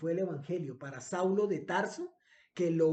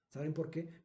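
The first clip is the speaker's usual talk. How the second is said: ordinary delivery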